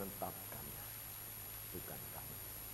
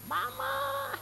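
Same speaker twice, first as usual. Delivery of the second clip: put-on high child's call; child's cry; 4 dB louder than usual. Waking child calling for mama, high cry.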